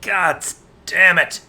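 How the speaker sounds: sudden loud exasperated curse; angry outburst. Acted. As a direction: blurt it out in frustration. Bartender hears of broken windows.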